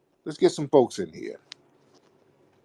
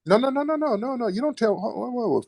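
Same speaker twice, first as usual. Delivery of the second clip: high-pitched rapid protest; cutting in; 11 semitones higher than usual. Real, not acted. Host overriding a caller who said hold on, high protest. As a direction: stop the caller with high, insistent no-no-no.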